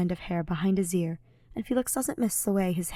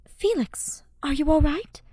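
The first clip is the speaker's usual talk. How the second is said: concerned Mercedes; 8 semitones higher, 3 dB louder than usual. A narrator's reading. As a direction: ask gently and alarmed.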